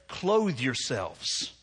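very slow, drawn-out Clothe yourselves; slow emphasis. Real, not acted. Preacher lingering on the metaphor, very slow. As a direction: stretch each word of Clothe yourselves. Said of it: "that's a powerful metaphor".

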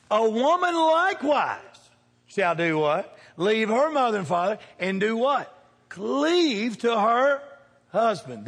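drawn-out pulpit recitation; preaching cadence. Real, not acted. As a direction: pull the congregation through the verse. Preacher quoting scripture, call-response.

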